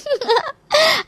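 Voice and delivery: high, bashful giggle; shy giggle. Acted. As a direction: laugh it off coyly. Wife laughs at teasing husband. (शर्माती हुई हँसी)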